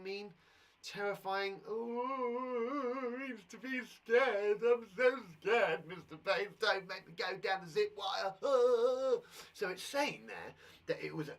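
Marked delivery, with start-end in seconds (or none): 1.7-8.3 mock scared child. 8.4-9.5 loud laugh. Real, not acted. Laughs at own act.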